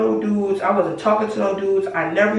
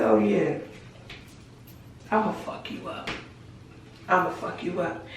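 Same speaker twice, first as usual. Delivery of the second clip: quiet threat; 10 dB quieter than usual. Retold or imitated.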